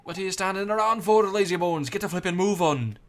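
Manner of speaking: furious Welsh fairy bellow; Welsh scolding shout. Acted. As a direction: yell at the lazy lad in a brash Welsh voice.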